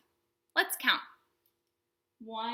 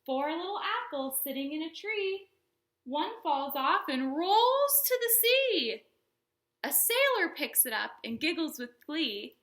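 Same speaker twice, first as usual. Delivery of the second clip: storytime rhyme; 3 semitones higher than usual. Acted. Reads counting rhyme to kids.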